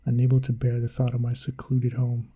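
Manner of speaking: ordinary delivery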